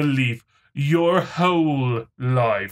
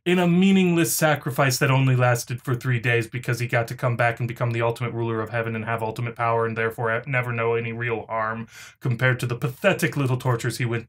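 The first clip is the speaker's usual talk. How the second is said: rushed sarcasm; 3 semitones lower, much faster than usual. Acted. Rushed sarcastic rant about Jesus.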